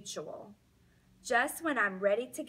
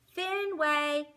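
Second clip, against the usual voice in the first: higher and louder, calling his name with hope; hopeful call; 7 semitones higher, 6 dB louder than usual. Acted. Girl calling her dog at the table, hopeful. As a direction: call the dog, hoping he comes. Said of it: "she calls in a hopeful voice".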